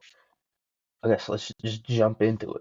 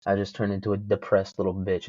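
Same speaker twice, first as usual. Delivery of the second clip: ordinary talk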